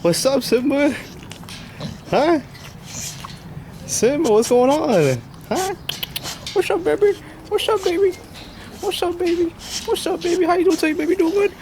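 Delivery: very high sing-song voice for the dog. Real, not acted. Cooing up high at his dog Simba. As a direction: coo up high like talking to a puppy.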